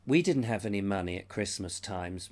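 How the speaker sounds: normal talking voice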